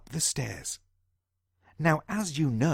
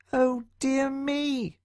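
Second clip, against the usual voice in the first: high, slow, drawn-out worry; slow mouse sigh; 10 semitones higher, much slower than usual. Acted. John Mouse despairing, slow and squeaky. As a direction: sigh it like a weary tiny mouse. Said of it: "thought John Mouse again".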